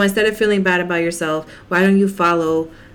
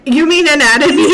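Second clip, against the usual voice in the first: high, loud disbelief; incredulous; 8 semitones higher, 8 dB louder than usual. Retold or imitated. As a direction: say it in stunned disbelief. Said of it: "I'm like".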